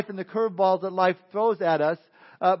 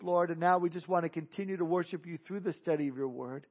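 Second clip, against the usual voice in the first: prayer; 2 semitones lower, 4 dB quieter than usual. Real, not acted. Pastor leads the congregation in prayer before his study, praying softly. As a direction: pray quietly and evenly, trailing down to the amen.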